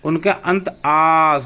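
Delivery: slow, stretching the final आ; teacher stretching the long vowel; drawn-out aa. Shown as a demonstration. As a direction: draw out आ so students hear it. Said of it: "उच्चारण के करते समय".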